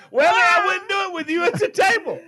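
laughing shout. Real, not acted. Teasing comeback amid laughter.

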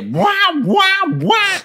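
very high wailing siren noise; repeated high-pitched "wah" siren; imitated alarm. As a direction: wail like a cockpit warning alarm. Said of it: "then it just hear".

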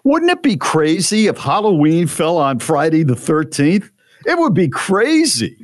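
high, wide-eyed mock wonder; hammed-up clueless excitement; naive amazement. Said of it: "I said this out loud".

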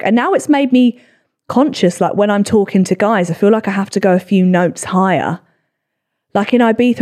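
ordinary talk, nothing marked

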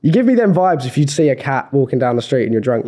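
normal talking voice

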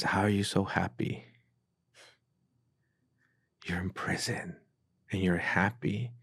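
softly imitating a puzzled question; soft, halting, dropping almost to a whisper; hushed bewilderment. Retells her bewildered question in prison.

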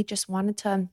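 ordinary talk

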